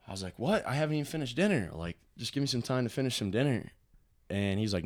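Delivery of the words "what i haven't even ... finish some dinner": higher-pitched, quick objection; retold protest at being rushed; surprised protest. Teen to brother over unfinished dinner, surprised protest.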